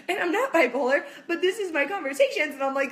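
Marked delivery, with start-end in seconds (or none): none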